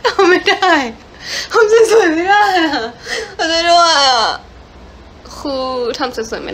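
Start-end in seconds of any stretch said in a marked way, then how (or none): 0.1-4.2 high, loud laughing cry of defeat; laughing helplessly. Real, not acted. Idol laughing to fans that she can't pose pretty, high and loud.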